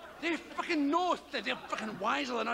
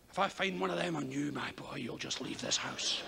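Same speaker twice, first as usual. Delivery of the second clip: low, dark, menacing parent voice; stern parent; 11 semitones lower, 4 dB quieter than usual. Retold or imitated. Parent threatens son over condoms in a low, stern voice. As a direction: warn the boy like a stern father.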